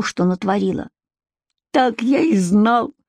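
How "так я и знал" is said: higher, drawn-out whine, close to tears; tearful exclamation. A narrator's reading. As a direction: wail it slowly in despair. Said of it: "плачущим голосом воскликнул Егор".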